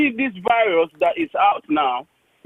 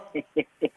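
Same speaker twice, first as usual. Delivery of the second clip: short amused chuckle; laughing; 5 semitones lower, 8 dB quieter than usual. Real, not acted. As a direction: let out a quick laugh on air. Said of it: "I can't stop laughing whenever I speak to you".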